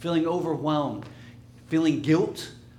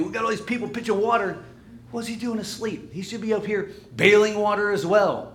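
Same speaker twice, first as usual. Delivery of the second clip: higher, louder, aggrieved protest; indignant complaint; 7 semitones higher, 5 dB louder than usual. Acted. Disciples grumbling about Jesus asleep during the storm, indignant. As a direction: complain like you're doing all the work.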